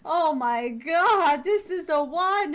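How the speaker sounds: high, slow, stretched-out rapture; loud swooning admiration; gushing awe. Retold or imitated. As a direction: gush over it, drawing every word out. Acting out rapture over the artwork.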